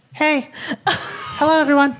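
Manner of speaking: put-on high-pitched eight-year-old voice; little kid imitation. Little kid greeting the game's voice chat, high child imitation. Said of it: "just, like, talking like that".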